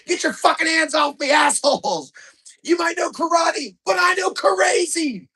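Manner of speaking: high, loud unhinged screaming; dad screaming at security as he's thrown out; raging yell. Retold or imitated. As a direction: thrash and scream while being dragged out. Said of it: "I hear my dad yelling".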